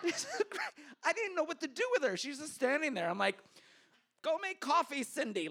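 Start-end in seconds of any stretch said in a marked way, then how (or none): 4.2-5.5 high, bossy order to an assistant; bossy movie boss. Retold or imitated. New hire bossing her assistant around like a movie executive, bossy. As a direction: play the big-shot boss from the movies. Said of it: "like in the movies".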